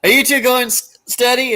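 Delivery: high, put-on teasing question; Larry impression. Larry teasing Moe and Curly as they hug, imitated high. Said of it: "And Larry's like".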